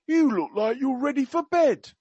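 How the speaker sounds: high, loud mock Cockney; attempted Cockney. Quoting the prince to a president, in attempted Cockney. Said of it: "I can't do Cockney. I will try my best".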